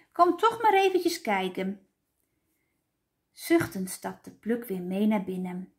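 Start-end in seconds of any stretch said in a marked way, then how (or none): 0.1-1.9 high-pitched little plea; pleading, pitched well up; coaxing child. A narrator's reading. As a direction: coax him over like an eager child.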